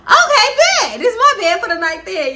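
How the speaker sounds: high, loud, laughing burst of relief; overjoyed squeal at getting the bed; gleeful laughing shout. Real, not acted. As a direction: cheer it out through laughter.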